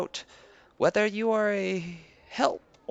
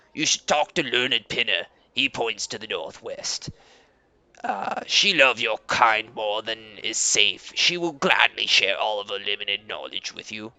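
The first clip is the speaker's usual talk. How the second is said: Leader Koba, proud; 11 semitones lower than usual. Acted. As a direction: greet guests with stiff pride. Koba speaks as NPC.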